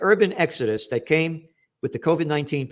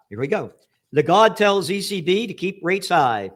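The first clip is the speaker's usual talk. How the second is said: normal talking voice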